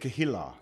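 Keilah as "kuh-HEE-lah", soft h, stress on HEE; Hebrew pronunciation. Shown as a demonstration. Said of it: "That's how they say it".

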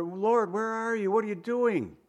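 acted bewildered appeal; questioning God. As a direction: voice a doubter's plea to the Lord. Voicing a doubter's cry to God.

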